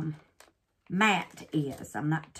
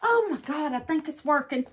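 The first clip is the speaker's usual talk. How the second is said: higher and a bit louder, thrilled; excited; 7 semitones higher, 4 dB louder than usual. Real, not acted. Crafter telling viewers the resin works, thrilled and bright. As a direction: bubble up with real excitement. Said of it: "I'm getting excited".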